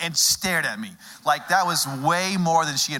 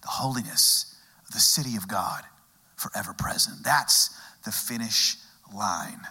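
hushed; 7 semitones lower, 4 dB quieter than usual. Acted. Hushed preaching climax.

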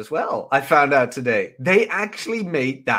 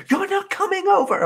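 much higher, mimicking the woman; mock girl's voice. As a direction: squeak out her excuse like the date. Girl's retold cancel message.

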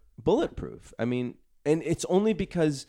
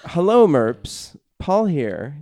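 pompous email voice; 2 semitones higher, 8 dB louder, much slower than usual. Acted. Reading email in voice.

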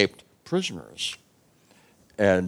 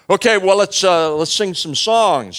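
cheery suggestion; 7 semitones higher, 5 dB louder than usual. Acted. Paul cheerfully proposing songs.

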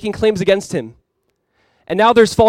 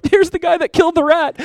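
put-on crying kids; 12 semitones higher, 3 dB louder than usual. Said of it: "come leaving out of the classroom crying".

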